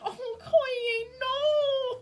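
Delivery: high, slow, teary wail; half-crying lament for Okoye; crying over Okoye's demotion; mock crying. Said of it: "It was like".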